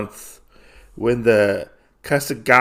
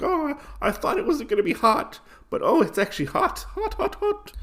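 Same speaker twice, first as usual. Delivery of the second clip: put-on high, squeaky child with burnt mouth; little child. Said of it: "like a little child".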